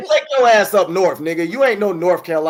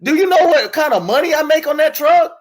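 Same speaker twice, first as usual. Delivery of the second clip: yelling; 8 semitones higher than usual. Real, not acted. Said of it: "why you screaming at me".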